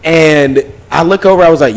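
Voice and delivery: normal talking voice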